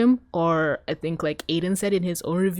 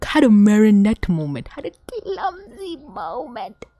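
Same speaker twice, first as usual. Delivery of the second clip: overwhelmed emphasis; 3 semitones higher, 4 dB louder, slower than usual. Real, not acted. Overwhelmed fan stressing the moment.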